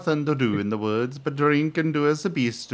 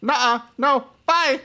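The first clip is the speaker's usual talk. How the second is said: put-on high, louder kid shouting no and bye; high, louder childish refusal; child refusing; 10 semitones higher, 4 dB louder than usual. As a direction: run off like a cheeky kid.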